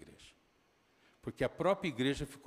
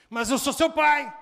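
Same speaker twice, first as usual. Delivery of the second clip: acted angry shout; 11 semitones higher, 12 dB louder, slower than usual. Father retold arguing with son.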